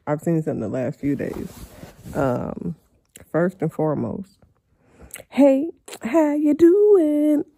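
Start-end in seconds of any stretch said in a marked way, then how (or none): 5.3-7.5 high silly voice. Pretend. Silly greeting voice at start.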